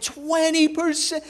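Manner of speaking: high, slow disbelieving question; incredulous. Retold or imitated. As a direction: Josh hears it was only twenty percent, stunned and incredulous. Shocked at massage pain.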